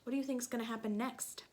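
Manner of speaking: ordinary delivery, nothing marked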